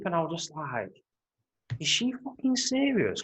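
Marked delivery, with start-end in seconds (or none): 1.8-3.2 high-pitched, disbelieving mock outrage; incredulous retold outburst. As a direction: act out indignant disbelief, pitched way up.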